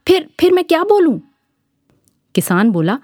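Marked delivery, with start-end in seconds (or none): 0.1-1.2 panicked Birju. Acted. Birju, chased by the angry farmer, begs in a high scared voice. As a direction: voice jumps up high in a scared squeak. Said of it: "Birju घबरा कर बोला".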